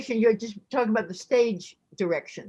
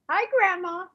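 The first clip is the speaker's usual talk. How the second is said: put-on higher boyish kid voice; a young boy greeting grandma, pitched up; Henry's boyish voice; 7 semitones higher than usual. Shown as a demonstration. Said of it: "I'll pretend I'm Henry".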